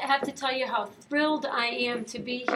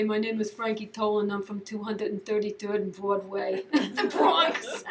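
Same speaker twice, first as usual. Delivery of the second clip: lightly put-on Bronx accent, laughing at the end; a hint of a New York Bronx guy introducing himself; 3 semitones lower than usual. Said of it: "friends who would talk like this".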